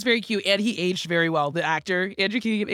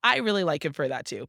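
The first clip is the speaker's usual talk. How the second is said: ordinary talk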